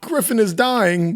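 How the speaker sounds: high-pitched, drawn-out fake anguish; mock melodrama. Podcaster spoofing Griffin's death scene, high mock anguish. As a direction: wail it like a cheesy old film.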